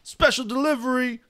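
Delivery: high sing-song put-on voice; delivery call. Voicing the comic's visitor, high delivery call. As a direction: call it out high, like announcing a parcel.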